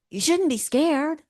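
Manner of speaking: high, sharp inner critic telling you off; scolding inner voice. Retold or imitated. Inner critic scolds the scared self, high and bossy. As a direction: lecture yourself in a bossy high voice. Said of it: "your other voice goes".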